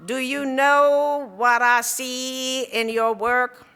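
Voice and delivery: slow, drawn-out, imitated stern Kentucky drawl; stern Kentucky accent. Angry professor condemning a young student's work, stern Kentucky accent. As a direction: pronounce judgment slowly and loudly in a rich Kentucky accent. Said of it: "this very stern voice and this very, uh, rich Kentucky accent".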